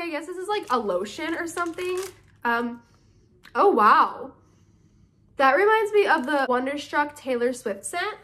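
ordinary talk

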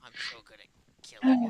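loud, fast self-satisfied gloat; smug boast. Acted. Still bragging to Puppet about the kill, loud gloat. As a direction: gloat over the win. Gloating after winning the game.

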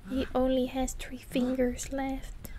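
soft, breathy whisper. Real, not acted. Player noticing a gory detail, hushed whisper. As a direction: whisper it in shock. Quiet aside during horror game.

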